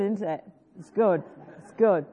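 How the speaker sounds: ordinary talk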